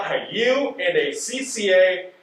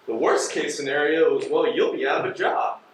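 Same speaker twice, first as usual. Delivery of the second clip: ordinary talk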